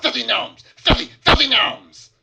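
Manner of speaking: high, loud goblin shriek; shouting goblin. Acted. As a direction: scream it like a furious goblin.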